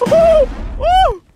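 very high loud whoop; victory whoop. Real, not acted. Gamer celebrating a kill, very high whoops. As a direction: whoop in triumph.

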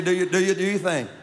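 ordinary talk, nothing marked

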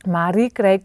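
plain reading voice

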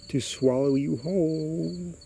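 high, slow, stretched-out threat; spooky creature. A narrator's reading. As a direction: draw out the last word eerily. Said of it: "the creature answers".

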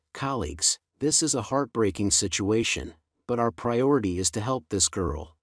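usual speaking voice

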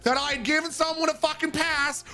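imitating sergeant. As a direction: wind up into a mock outraged sergeant voice. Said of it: "And he was like".

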